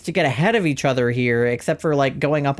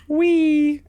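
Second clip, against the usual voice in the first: squeaky marble voice; 12 semitones higher than usual. Pretend. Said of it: "Okay, I'll stop doing that".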